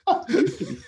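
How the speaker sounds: high, laughing mockery of a friend; mocking laugh. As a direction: tease him through a laugh. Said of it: "Some of them will go".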